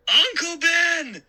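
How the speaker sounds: high, loud, drawn-out terrified scream. Acted. Boy screams to warn his uncle, terrified. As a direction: scream the warning at him. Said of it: "I finally managed to scream".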